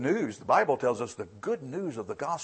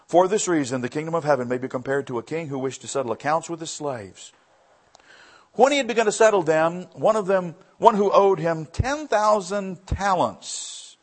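raised, driving Bible reading; scripture reading; 4 semitones higher, 3 dB louder than usual. Acted. Preacher reading the parable to the congregation, raised and driving. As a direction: read the verse out to the church.

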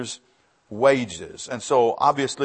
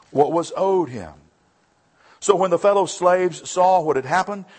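raised, driving Bible reading; scripture reading; 5 semitones higher, 5 dB louder than usual. Acted. Preacher reading the parable to the congregation, raised and driving. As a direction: read the verse out to the church.

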